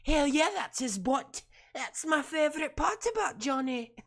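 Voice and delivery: put-on high-pitched Scottish accent; Rose Leslie. Streamer imagining her cheering his butt, Scottish accent. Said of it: "I like my Scottish accent".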